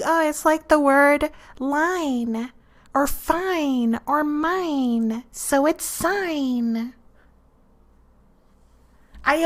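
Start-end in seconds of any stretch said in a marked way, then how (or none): 0.0-6.9 higher, soft, slow reasoning voice; lightly mimicked puzzled reader; imitating naive kids. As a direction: sound out the logic innocently, word by word. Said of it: "and it's like".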